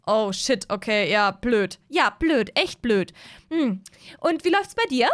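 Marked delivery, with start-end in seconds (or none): 4.2-5.1 put-on higher, prying young woman; Mara's suspicious voice. Mara catching Moritz on the dating platform, high and prying. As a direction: catch him out on the dating app.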